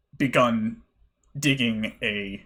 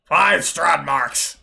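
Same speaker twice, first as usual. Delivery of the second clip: slow gruff shopkeeper; 5 semitones higher, 5 dB louder, much slower than usual. Acted. Shopkeeper names a price.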